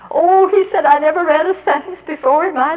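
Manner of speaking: loud, high, thrilled cry; thrilled outburst. Retold or imitated. Old pupil thrilled at his first sentence, loud. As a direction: burst out in joy at reading.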